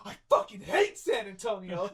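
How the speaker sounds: loud mock outburst; hammed-up loathing; retold yelling. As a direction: imitate an angry rant, punching the words. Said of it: "she yelled in my face".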